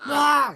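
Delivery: shrill, loud bird cry; a big high raven caw; raven squawk. Retold or imitated. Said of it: "the raven goes".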